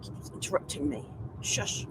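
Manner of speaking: quiet grumble under breath; muttered aside. Real, not acted. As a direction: mutter it, mildly annoyed. Annoyed at chattering satnav.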